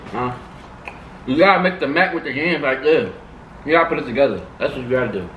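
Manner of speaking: eating between words; talking while chewing. Real, not acted. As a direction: mouth full of food. Seen 4: male speaker eats mac and cheese throughout, mouth full.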